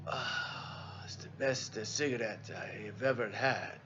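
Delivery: put-on soft, slow, breathy Italian-accented sigh of pleasure; near-silent Italian accent, drawn out; Italian captain accent, hushed. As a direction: savor it like fine wine in an Italian accent. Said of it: "Italian, you know, American broken English".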